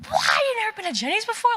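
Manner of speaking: high, rushed incredulous outcry; mock disbelief. Ice cream fans reacting to her confession, mock-incredulous. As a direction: blurt it out as the shocked group.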